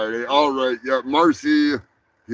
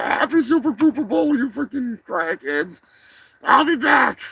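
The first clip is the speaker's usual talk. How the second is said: high squeaky voice, fast and frantic; a little sidekick panicking and arguing; panicky high character; 11 semitones higher than usual. Acted. Sidekick arguing about aborting the mission, frantic high squeak.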